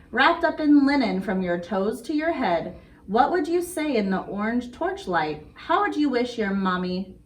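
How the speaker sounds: ordinary talk, nothing marked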